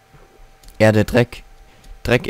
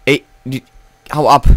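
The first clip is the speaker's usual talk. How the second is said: ordinary talk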